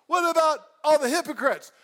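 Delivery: a churchgoer's loud indignant gripe; indignant complaint. Pretend. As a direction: protest it loudly. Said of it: "if you're like".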